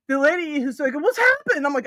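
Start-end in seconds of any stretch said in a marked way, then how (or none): 0.9-1.7 high imitation of a startled onlooker; mock shocked question. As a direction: imitate alarm, pitched way up. Retelling the lady's alarmed question.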